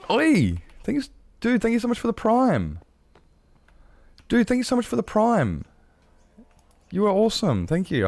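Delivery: high, gushing thank-you; excited thanks. Real, not acted. Streamer thanking a viewer for the Prime sub, excitedly high. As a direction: gush with gratitude, pitch way up.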